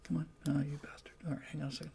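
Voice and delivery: under-the-breath whisper to himself; whispered mutter. Real, not acted. Host reading the comments mutters to himself, whispered. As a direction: grumble it almost silently, annoyed.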